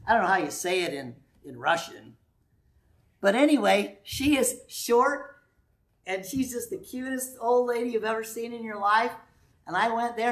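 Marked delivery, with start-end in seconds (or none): none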